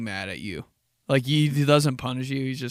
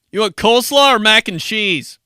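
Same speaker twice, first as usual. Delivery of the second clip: high, loud pointed question; grandpa's loud warning; 8 semitones higher, 10 dB louder than usual. Retold or imitated. Grandpa repeats himself loud as a warning. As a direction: ask it loud as a veiled threat. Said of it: "Or, like".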